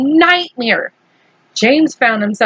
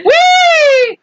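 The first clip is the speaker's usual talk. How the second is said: excited shouting; 10 semitones higher, slower than usual. A narrator's reading. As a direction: cheer like riding a rollercoaster. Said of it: "and shouting".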